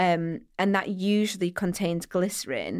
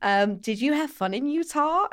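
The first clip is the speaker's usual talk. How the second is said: higher, louder over-friendly American voice; Robert; 8 semitones higher, 3 dB louder than usual. Retold or imitated. Optician emailing tourists he met, mock over-eager American voice. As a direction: imitate an eager, chummy stranger. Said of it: "then we had an email".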